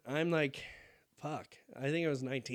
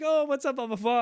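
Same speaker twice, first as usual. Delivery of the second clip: high excited greeting; 10 semitones higher, 8 dB louder than usual. Retold or imitated. Parents greeting, retold.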